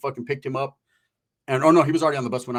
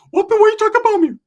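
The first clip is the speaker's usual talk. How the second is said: fast, high, loud squeaky challenge; cartoonish mock shouting; Ricky confronting them; 8 dB louder, faster than usual. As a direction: imitate an indignant hothead in falsetto. Acting out Ricky confronting the men.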